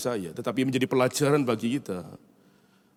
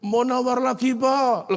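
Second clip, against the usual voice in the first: very high, loud whiny request; pleading child; 13 semitones higher, 5 dB louder, slower than usual. Retold or imitated. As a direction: whine it like a cheeky kid. Son bargains again.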